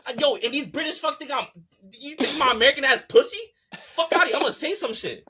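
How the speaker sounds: high, loud, worked-up rant; crashing out. Real, not acted. Fan riled by British critics, crashing out. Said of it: "I'm crashing out".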